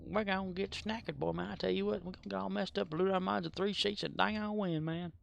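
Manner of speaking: slurred country mom rambling; Boomhauer's mother. Retold or imitated. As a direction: rattle it off like an old Texan mother. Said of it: "Boomhauer's mother speaks the same way".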